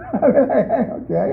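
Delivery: okay through a laugh. Real, not acted. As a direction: say it while still laughing. Laughing after the story.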